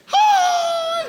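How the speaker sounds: very high, loud squealed hi; shrieking camp hello; camp Jesus greeting. Pretend. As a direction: pop out with a flamboyant squeal. Jesus coming out of closet.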